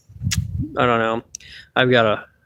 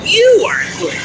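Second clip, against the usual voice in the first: high, louder squeaky puppet repeat; high puppet echo; 12 semitones higher, 5 dB louder than usual. Acted. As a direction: pitch it way up like the puppet talking. Puppet Phil answers in his voice.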